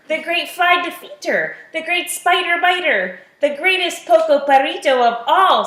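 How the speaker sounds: high-pitched, grandiose self-introduction; boastful hero. A narrator's reading. Little Skipito lists his titles to the shack, boasting. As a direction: proclaim each title with swagger.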